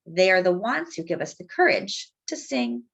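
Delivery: ordinary delivery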